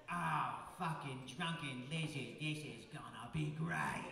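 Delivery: lightly imitated Aussie drawl, slowing at the end; mock Australian bloke, laughing; a hint of an Aussie lad greeting the Yanks; Australian accent. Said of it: "Australian guys".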